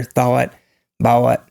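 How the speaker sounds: "thawat", "bawat", each vowel gliding through w; Southern diphthong. Shown as a demonstration. Listing what you'll hear in the South, diphthongized "thawat", "bawat". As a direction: split "thawat" and "bawat" into two syllables. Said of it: "You'll hear".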